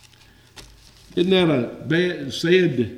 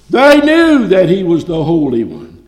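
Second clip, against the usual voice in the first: forceful preaching; 3 semitones higher, 7 dB louder than usual. Acted. Preacher stresses what demons knew.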